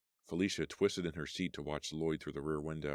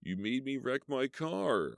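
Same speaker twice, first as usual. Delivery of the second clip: slow, higher groaning anger; injured man groaning in rage; 9 semitones higher, slower than usual. Acted. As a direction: moan it through pain. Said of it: "Lloyd groaned".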